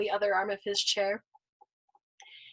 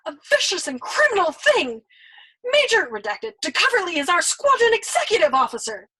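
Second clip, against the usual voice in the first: higher, louder reproach; reproachful shout; 8 semitones higher, 7 dB louder than usual. Acted. Nately scolding the old man, loud reproachful shout. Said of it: "Nately shouted at him reproachfully".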